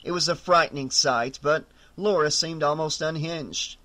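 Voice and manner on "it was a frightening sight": ordinary delivery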